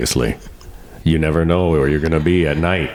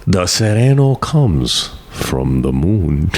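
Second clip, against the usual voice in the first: slow ominous; 2 semitones higher, much slower than usual. Acted. Mock ominous statement.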